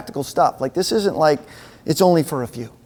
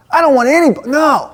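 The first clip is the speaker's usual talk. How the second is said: indignant refusal; 7 semitones higher, 4 dB louder than usual. Pretend.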